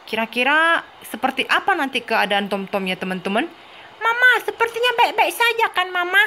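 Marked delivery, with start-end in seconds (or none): 4.0-6.2 put-on high child voice, a touch worried; a little one seeking reassurance, pitched high; baby asking Mama if all is well, high child voice; baby Tom Tom voice. Baby worried before hospital.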